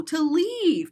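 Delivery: ordinary delivery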